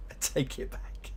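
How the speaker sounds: whispered "I take it back" under the breath. Shown as a demonstration.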